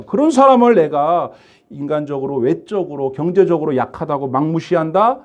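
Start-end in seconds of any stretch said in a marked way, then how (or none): none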